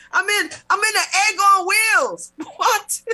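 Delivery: high, loud incredulous outburst breaking into laughter; laughing disbelief. Real, not acted. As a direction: exclaim it high through real laughter.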